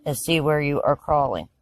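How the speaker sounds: hushed, measured meditation voice; calm narration with lots of space between phrases; guided visualization. Acted. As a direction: guide them through the scene phrase by phrase, leaving long pauses.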